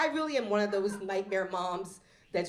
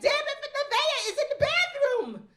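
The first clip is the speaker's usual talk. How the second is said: high, loud, cracking up through it; laughing exasperation; 7 dB louder than usual. Retold or imitated. Mom snaps at confused son, laughing and loud. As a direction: blurt it out mid-laugh.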